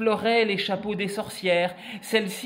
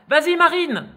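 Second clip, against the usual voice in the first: loud, high cheer; encouraging cheer; 10 semitones higher, 7 dB louder than usual. Acted. Witches to Marine before her jump, loud high cheer. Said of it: "l'encouragèrent les sorcières".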